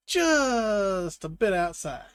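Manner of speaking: slow, dry announcer call; deadpan it like a ballgame announcer. Pretend. Quoting a baseball announcer.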